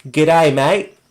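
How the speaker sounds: broad, hammed-up Aussie greeting; mock Australian accent. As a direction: send up your own Australian accent. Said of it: "make fun of my Australian accent".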